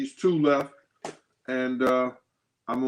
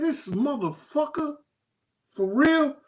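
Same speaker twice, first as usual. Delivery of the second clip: mock disbelief; 12 semitones higher than usual. Retelling his disbelief at the studio.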